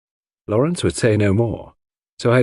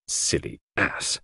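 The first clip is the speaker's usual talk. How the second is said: muttered exclamation; 3 semitones lower, 9 dB quieter, slower than usual. Acted. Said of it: "I ejaculated".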